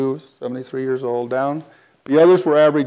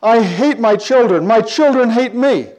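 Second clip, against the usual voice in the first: slow, high, louder lament; distraught woman's repeated cry; 7 semitones higher, 5 dB louder than usual. Retold or imitated. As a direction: imitate an anguished woman wailing it out. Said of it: "She just kept saying this over and over".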